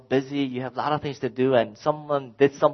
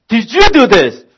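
louder, insistent repeat; angry demand; 9 semitones higher, 11 dB louder than usual. Retold or imitated. Dad questioning the third kid, insistent and loud. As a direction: press the last kid hard.

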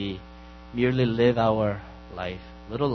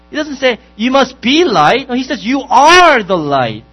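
full-force preaching; 8 semitones higher, 13 dB louder than usual. Acted. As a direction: proclaim it, leaning hard on each word. Pastor preaching on salt and light.

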